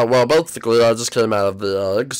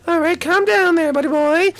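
very high, small character voice; squeaky cartoon voice. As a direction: chirp like a tiny cartoon sidekick. High character calms Toad.

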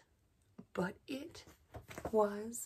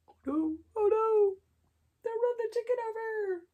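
alarmed oh no. Acted. Alarmed aside about chicken.

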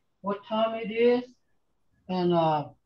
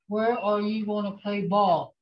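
ordinary delivery

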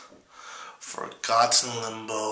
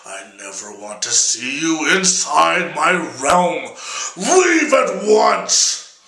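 angry devil; 4 dB louder than usual. Acted. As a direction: banish Jack from hell in a rage. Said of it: "the devil goes".

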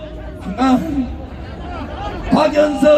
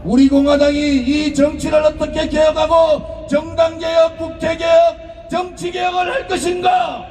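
raised, shouted political oratory; rally speech; 4 semitones higher than usual. Acted. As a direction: rouse the crowd like a party leader.

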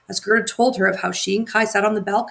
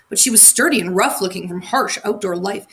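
plain reading voice

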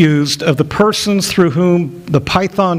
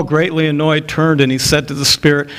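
ordinary talk

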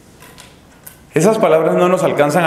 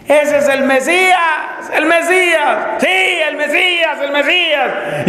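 acted, high-pitched acclaiming yell; crowd shout; 14 semitones higher than usual. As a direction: shout it out like someone in a multitude. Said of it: "alguien grita en la multitud".

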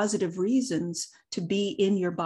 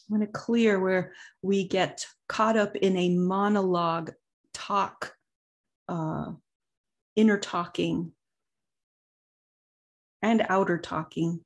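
guided meditation. Acted. Leads a meditation, long pauses.

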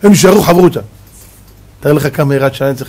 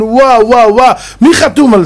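marvelling; 6 semitones higher than usual. Acted. Marvelling at the night's study.

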